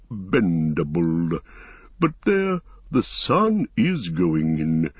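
slow tree-creature with big rising and falling tune; Treebeard, mildly affronted, then musing. Acted. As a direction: draw out each word, pausing to hum. Voices Treebeard, surprised then musing.

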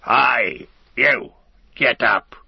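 loud, high slave-driver yell; rough orc overseer shouting them to their feet; yelled orc command. A narrator's reading. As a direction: yell orders at stragglers. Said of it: "flicked a whip at them and yelled".

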